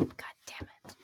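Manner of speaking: breathy whispered curse under the breath; whispering. Real, not acted. Exasperated aside after being interrupted.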